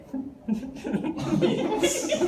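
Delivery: real laughter bubbling out; bright burst of laughter. Real, not acted. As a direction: laugh out loud. Laughing after funny face exercise.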